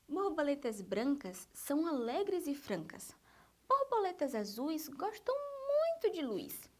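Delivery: lively, singsong verse reading with lifted key words; reading a poem to children. Acted. Teacher reading a butterfly poem to first graders, lively poem reading. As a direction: read it playfully, lingering on the rhymes.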